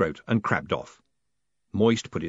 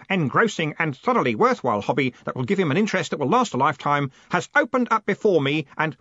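high-pitched earnest speech; eager Stanley, gushing; 7 semitones higher, 4 dB louder than usual. Acted. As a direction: gush on earnestly about the new hobby.

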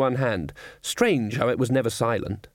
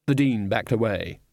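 ordinary delivery

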